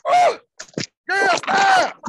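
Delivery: very high, loud squealed protest. Real, not acted. Brushing off the friend, very high playful squeal. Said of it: "So I was like".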